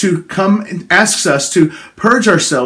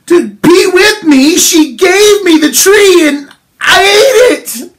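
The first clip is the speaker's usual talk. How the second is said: high, crying whine, then a shout; whiny Adam; 10 semitones higher, 4 dB louder, slower than usual. Acted. Preacher acting Adam blaming God, crying then shouting. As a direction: sob out the excuse and then yell the blame. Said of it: "So he just said".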